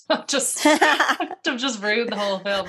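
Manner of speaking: laughing through a spoiler confession; sheepish laugh over the words. Real, not acted. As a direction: giggle the words out, slowed.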